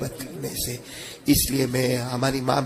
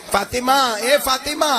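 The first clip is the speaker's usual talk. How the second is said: raised calling voice, stretched name; the Prophet calling his daughter by name; calling out to Fatima; 8 semitones higher, slower than usual. Retold or imitated. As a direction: call her name across the room.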